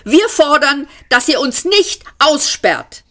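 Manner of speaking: high, loud angry snap; snapping. A narrator's reading. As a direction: snap the demand at them. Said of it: "schnauzte der Jüngere". (hohes, lautes, wütendes Anschnauzen)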